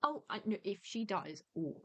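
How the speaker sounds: ordinary talk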